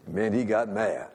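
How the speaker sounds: ordinary talk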